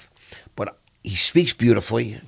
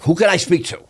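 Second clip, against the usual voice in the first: higher, heated challenge; angry demand; 8 semitones higher, 4 dB louder than usual. Retold or imitated. Angry man demanding the officer's supervisor. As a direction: push back hard at the officer. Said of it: "I'm really going off on this guy".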